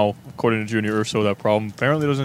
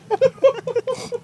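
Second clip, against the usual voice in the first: squeaky burst of laughter; high laugh. Real, not acted. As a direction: cackle up high. Laughing at bean reaction.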